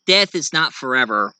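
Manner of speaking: usual speaking voice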